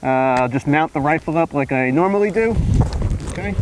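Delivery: muffled behind a gas mask; talking inside a respirator mask; through a gas mask. Real, not acted. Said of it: "go ahead and put this gas mask on".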